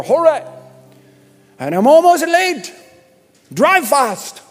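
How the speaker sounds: high, loud hammed-up Irish accent. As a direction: ham up a rushed Irish traveller. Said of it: "It's the best Irish I can do".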